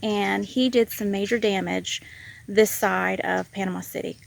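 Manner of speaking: normal talking voice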